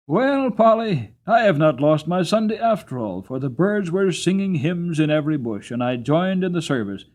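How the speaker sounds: joyful homecoming. Acted. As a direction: call to your wife full of delight after a happy Sunday. Jerry returns home joyful.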